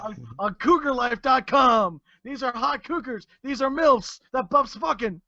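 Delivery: high, hammed-up wrestler promo voice; Buff Bagwell impression. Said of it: "I feel some Austin coming on".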